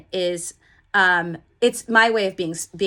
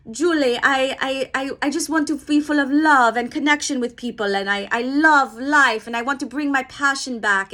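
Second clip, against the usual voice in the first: high, lively imitation of an Italian accent with a put-on timbre; bad Italian accent; 5 semitones higher than usual. Coach imitating her Italian client's words in an Italian accent. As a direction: mimic her Italian lilt, heartfelt and a bit hammed-up. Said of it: "my accent is so bad".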